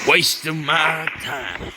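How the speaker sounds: lightly voiced cowboy; a hint of a slow Western gunslinger. Player tries out an old character voice, half-hearted cowboy. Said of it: "I need to get my cowboy voice going again".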